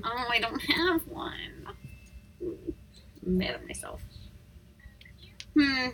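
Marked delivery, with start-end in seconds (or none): 0.0-4.0 breathy whispered grumble, slowed; soft whispered self-scolding. Real, not acted. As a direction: mutter it to yourself, annoyed. Stuck for a song, sulking.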